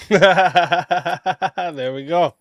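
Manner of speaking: drawn-out laugh through the words. Real, not acted. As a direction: laugh it out slowly. Host laughs at a quick answer.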